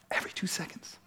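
whispered. Real, not acted. Pastor repeats the statistic to the congregation in a whisper. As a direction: drop to a whisper for weight.

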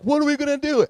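high, loud panicked cry; a parent frantic over a lost kid. Retold or imitated. Parents searching for their missing son, frantic and loud. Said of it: "we're frantically like".

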